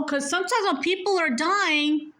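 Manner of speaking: ordinary talk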